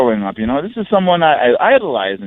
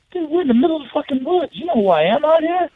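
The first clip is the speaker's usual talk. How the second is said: high-pitched disbelief; stunned, high-pitched disbelief; incredulous, high; 12 semitones higher than usual. Retold or imitated. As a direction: sound amazed he was recognized out there. Telling kid they're in woods.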